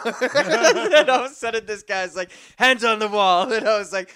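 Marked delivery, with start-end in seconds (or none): 2.6-3.5 cop's order. Retold or imitated. Cop orders him while arrested.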